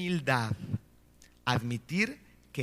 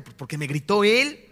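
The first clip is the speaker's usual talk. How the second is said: lightly acted blame-shifting, louder and higher; mock defensive excuse; 5 semitones higher, 7 dB louder than usual. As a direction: play a childish self-justification. (excusa defensiva fingida, más aguda)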